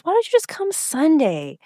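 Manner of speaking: high, coaxing mock quote; retold pleading suggestion. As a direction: offer it up high as a retold teen.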